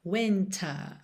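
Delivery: "Winter" ending in a long "ah", no R; British "wintaa"; British accent. Shown as a demonstration. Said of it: "ao invés de winter".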